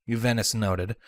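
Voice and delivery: ordinary delivery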